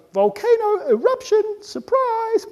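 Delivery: high, drawn-out singsong announcement; Mother Nature. Acted. Mother Nature springing disaster on humans, high singsong. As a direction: trill it out like a gleeful surprise. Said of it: "Next, Mother Nature goes".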